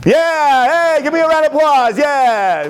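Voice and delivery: high, loud victory whoop; triumphant cheering. Real, not acted. Magician teacher after guessing the card right, triumphant cheering. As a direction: whip up the room for applause. Celebrates trick, demands applause.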